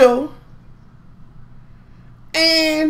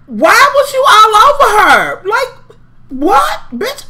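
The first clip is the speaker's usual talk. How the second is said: high, loud incredulous yell; outraged shout; 8 semitones higher, 4 dB louder, slower than usual. Real, not acted. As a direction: shout it at the screen in disbelief. Outraged at the fight on screen.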